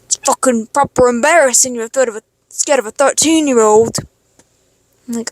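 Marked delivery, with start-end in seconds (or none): 0.0-4.0 mock British accent of an angry boy; imitated British boy. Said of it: "He's like".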